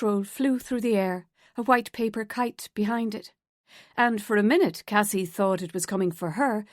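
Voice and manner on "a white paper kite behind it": usual speaking voice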